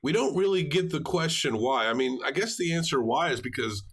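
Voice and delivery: ordinary talk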